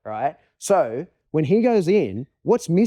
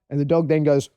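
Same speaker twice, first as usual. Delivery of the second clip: usual speaking voice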